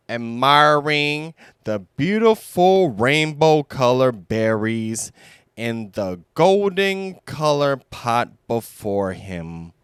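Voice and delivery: usual speaking voice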